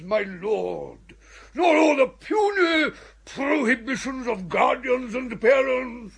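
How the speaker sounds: usual speaking voice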